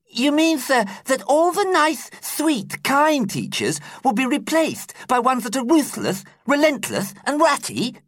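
put-on very high little girl voice; a nervous girl questioning her teacher; schoolgirl asking. A narrator's reading. Lucasta asking her teacher in a very high girlish voice.

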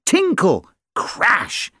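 tinkle and crash. Acted. Dish and spoon falling.

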